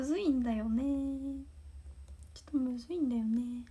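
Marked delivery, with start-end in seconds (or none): 2.3-3.6 whispered repeat. Real, not acted. Repeating her line to fans as a private whisper. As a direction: repeat ちょっとむずいんだよね as a breathy whisper with no voice.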